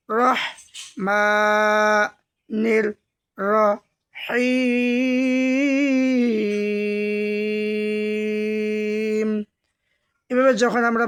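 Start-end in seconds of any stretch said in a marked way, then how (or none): 0.1-9.4 each word drawn out very slowly, one at a time; slow chanted Quran reading; broken slow recitation. Shown as a demonstration. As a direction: recite word by word with long pauses. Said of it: "ভাঙা ভাঙা উচ্চারণ করবেন".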